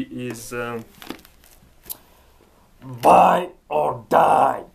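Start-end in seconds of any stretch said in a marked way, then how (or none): 3.0-4.6 mock slogan. Mock harsh business slogan.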